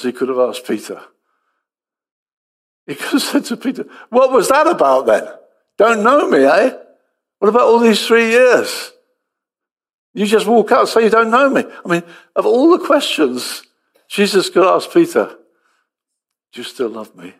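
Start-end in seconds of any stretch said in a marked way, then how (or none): 4.1-11.6 louder, higher, an indignant confronting of Peter; harsh questioning. Pretend. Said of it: "he could have had such harsh questions". 16.5-17.3 gentle. Pretend. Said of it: "It's that gentleness".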